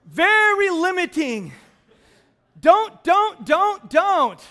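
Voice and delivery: loud, slow, high mock warning; mock sign reading. Pastor reading a bossy sign to the congregation, loud mock warning. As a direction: bark out the sign's commands.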